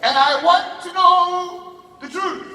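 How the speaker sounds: high, loud mock interrogation; hammed-up parental outrage; mother's confrontation. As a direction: demand it like an upset mom. Mother confronts son, acted.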